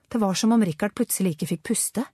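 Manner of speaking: ordinary delivery, nothing marked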